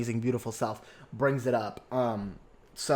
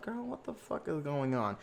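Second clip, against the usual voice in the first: hushed, disbelieving aside; stunned aside; 11 dB quieter than usual. Retold or imitated. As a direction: mutter it under your breath in disbelief.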